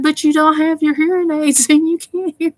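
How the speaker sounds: high-pitched imitation of a bickering yell; mock yelling. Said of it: "they're yelling at each other".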